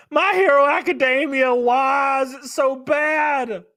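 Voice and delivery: slowed, stretched-out angry yell; exasperated shout. Real, not acted. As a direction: bellow your outrage at the show. Ranting at hated anime.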